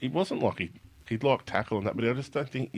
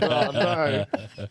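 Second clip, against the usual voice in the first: laughing oh no; 9 semitones higher, 5 dB louder than usual. Retold or imitated. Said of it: "I was like".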